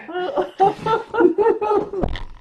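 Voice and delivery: long high-pitched laughter. Real, not acted. Storyteller cracks up after retelling it, high laugh.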